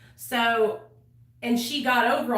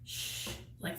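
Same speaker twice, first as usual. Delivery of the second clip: shushing whisper; 15 dB quieter than usual. Retold or imitated. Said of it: "she'd go".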